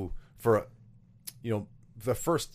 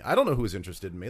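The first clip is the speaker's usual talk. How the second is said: ordinary delivery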